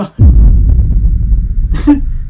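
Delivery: loud airy burst of laughter; breathy laugh. Real, not acted. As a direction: laugh off the absurd idea. Laughs at own mockery.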